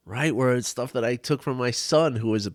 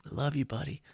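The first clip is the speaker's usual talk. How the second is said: put-on tender to inner child; 9 dB quieter than usual. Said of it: "look at that and say".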